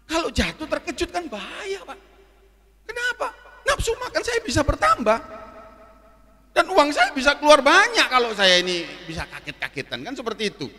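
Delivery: tinggi, keras, penuh keluhan dibuat-buat; protes berlebihan. Retold or imitated. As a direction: protes pelanggan yang didramatisasi. Memerankan protes kepada manajer restoran. (protes heboh dengan nada melengking tinggi)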